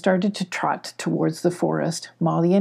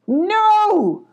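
high, drawn-out loud holler; 5 dB louder than usual. A narrator's reading. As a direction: holler it long and high in alarm. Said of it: "hollered Spunky".

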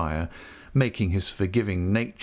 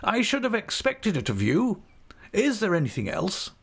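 pitched-up questioner, pleased and patronising; a self-satisfied official praising the prisoner; high, smug magistrate voice; 12 semitones higher than usual. A narrator's reading. Magistrate commending a prisoner in a high smug voice.

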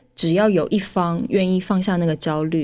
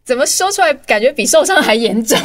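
high and louder, amused exclaim; amused disbelief; 6 semitones higher, 6 dB louder than usual. Real, not acted. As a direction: protest in mock alarm. (又好笑又惊讶地高声说)

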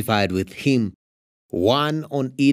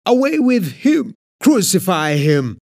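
yelling; 7 semitones higher, 5 dB louder than usual. A narrator's reading. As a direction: scream the demand at him. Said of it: "they yelled".

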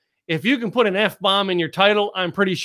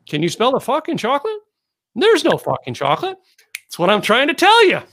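exasperated punchline; 7 semitones higher, 3 dB louder than usual. Retold or imitated. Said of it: "the guy at the counter at the ice cream shop goes".

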